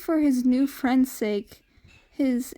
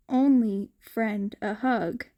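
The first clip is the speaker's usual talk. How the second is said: ordinary delivery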